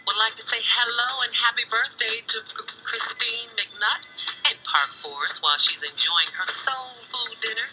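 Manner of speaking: usual speaking voice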